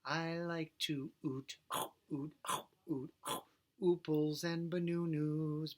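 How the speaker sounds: "eat" sung as "oot", "bananas" as "banunus"; U vowel swap. Shown as a demonstration. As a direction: sing every vowel as OO. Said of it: "the U sound".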